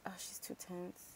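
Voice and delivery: hushed whisper; a whispered aside. Real, not acted. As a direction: whisper it so no one hears. Aside while someone else plays.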